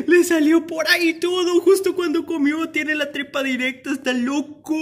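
high voice just after a laugh; high-pitched hilarity; amused disbelief. Real, not acted. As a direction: squeal it out, tickled and amazed. Laughing about the bird pooping.

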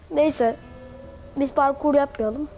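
ordinary talk, nothing marked

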